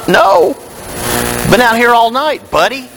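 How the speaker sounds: tired fisherman waving off a stranger, fast at the end; grumpy fisherman. Acted. Peter answers voice from shore.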